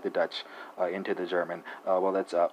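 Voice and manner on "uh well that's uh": ordinary talk, nothing marked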